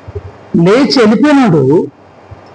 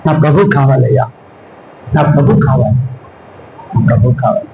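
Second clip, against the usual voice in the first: grieving plea; 9 semitones lower than usual. Acted. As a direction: sink low and beg for him. Mary repeats her longing. (దుఃఖంతో వేడుకోలు)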